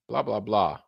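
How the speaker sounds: usual speaking voice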